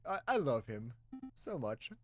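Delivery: ordinary talk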